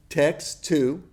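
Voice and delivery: usual speaking voice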